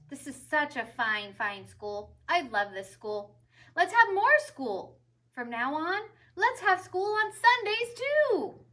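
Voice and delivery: booming high proclamation, building; rousing head teacher speech; proud principal. A narrator's reading. Principal to students and teachers, grand and rising. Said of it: "Mister Keene announced".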